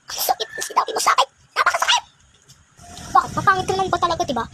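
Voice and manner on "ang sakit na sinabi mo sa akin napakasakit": very high, whispery anguished cry; hurt wail. Acted. Hurt girl crying out. (mataas na iyak sa sakit)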